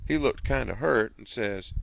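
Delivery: ordinary delivery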